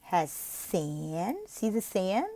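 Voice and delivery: slow, simple explaining; very simple slow explanation. Shown as a demonstration. Tutor models a simple explanation, slowly. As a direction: spell it out slowly for a child. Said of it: "You can say".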